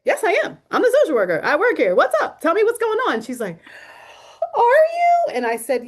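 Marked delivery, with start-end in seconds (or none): 0.0-3.2 much higher and louder, insisting brightly; emphatic insistence. Retold or imitated. Insisting she is the social worker. 4.6-5.3 much higher and louder, near tears; stunned disbelief. Retold or imitated. Patient's stunned question, retold.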